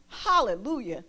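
ordinary delivery

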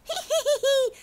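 high teasing little laugh; cheeky high giggle. A narrator's reading. Gingerbread cowboy laughing at the rancher, high giggle. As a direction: giggle up high like a naughty cookie. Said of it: "se rió y dijo".

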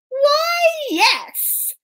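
high, lilting "why", then settle; obliging Puss. Acted. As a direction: answer the king like a flattering cat. Puss answers the king.

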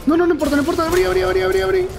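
very high, frantic repeated cries; panicked shouting. Real, not acted. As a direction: beg the door open in sheer panic. Desperate escape through a door. (gritos de pánico, muy agudos y desesperados)

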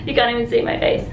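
ordinary delivery

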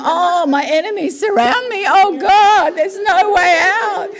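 high, melodramatic mock wail; hammed-up whinge, dragging out the despair; David. Warrior king whinging to God in the Psalms, melodramatic. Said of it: "David is having the biggest whinge".

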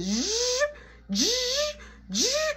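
buzzing drill imitation, rising high; drill noise. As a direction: buzz like a power drill.

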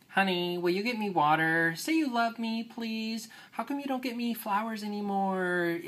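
put-on high, pleading, needy girlfriend voice; whiny ex-girlfriend. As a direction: beg for attention like a clingy ex. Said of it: "that's kind of the whiny ex-girlfriend there".

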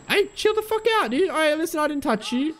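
very high, shrill-pitched shouting; startled yell. Real, not acted. Player yelling at a monster that grabbed him, high alarmed shout.

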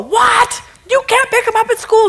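incredulous boss shouting high; outraged boss. Acted. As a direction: bark disbelief at the worker. Boss scolding the employee.

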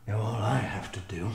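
usual speaking voice

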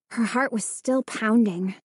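ordinary delivery, nothing marked

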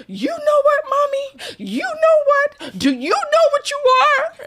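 put-on high, loud, hurt little-boy voice; squeaky toddler winding up an insult; upset toddler. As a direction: act a furious three-year-old. Toddler angrily insulting mom.

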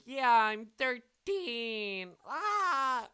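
high, slow, excited squeal; mock excited kid. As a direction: cheer like a kid who just turned thirteen. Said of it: "I'm like".

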